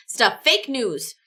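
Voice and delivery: ordinary talk, nothing marked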